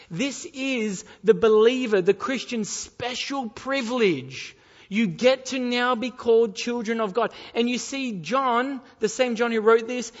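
loud, impassioned pulpit delivery; forceful preaching. Real, not acted. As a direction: drive the point home to the congregation.

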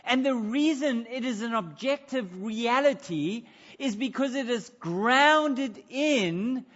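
loud, high preaching with big emphasis; full-force preaching. Acted. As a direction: preach it out to the congregation, forceful and rising.

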